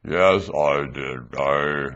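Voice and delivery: slow, low puppet voice. Acted. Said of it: "Puka's gonna speak for itself".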